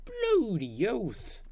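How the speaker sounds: slow, high Australian bloody oath; Australian accent. Pretend. Gamer tries an Aussie accent. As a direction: drawl it like an Aussie. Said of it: "I said I wasn't good at accents".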